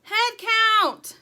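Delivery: high, loud, drawn-out command; yelling. A narrator's reading. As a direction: bellow the order to everyone. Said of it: "Dirt and Sugar yelled".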